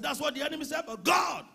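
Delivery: loud high shout. Retold or imitated. Pastor shouting back at the enemy's lie. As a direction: declare it at full force. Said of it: "I said".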